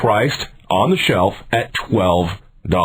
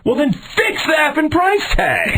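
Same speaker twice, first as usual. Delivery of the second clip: very high, drawn-out exasperated yell; high, strained demand; angry outburst; slower than usual. Real, not acted. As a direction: lose your temper at a stupid excuse, slowing for emphasis. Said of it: "Anger management".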